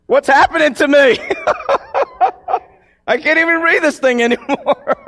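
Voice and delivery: high, helpless laughter through the words; real laughing. Real, not acted. Preacher unable to read his text before the congregation, laughing. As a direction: talk through a laughing fit.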